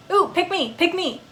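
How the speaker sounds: high, excited pleading; eager begging. Acted. Dog begging the player to pick him, high and eager. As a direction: bounce with hope to be chosen.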